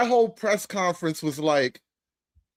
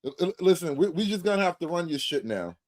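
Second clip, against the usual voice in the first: usual speaking voice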